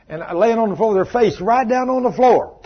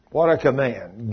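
ordinary talk, nothing marked

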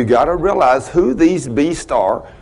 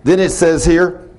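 ordinary delivery

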